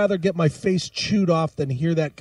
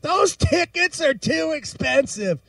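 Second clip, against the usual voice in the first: mock angry rant; 7 semitones higher than usual. Ranting back at caller's wife.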